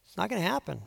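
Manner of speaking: hushed, unhurried assurance; calm. Acted. God reassuring his people, quietly calm. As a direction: say it softly, no worry at all. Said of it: "God just calmly says".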